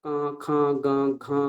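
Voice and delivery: কা, খা, গা, ঘা with vowels sent through the nose; nasalised pronunciation. Shown as a demonstration. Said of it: "ক বর্গকে যদি আমরা অনুনাসিক ভেবে নিই". (অনুনাসিক উচ্চারণ)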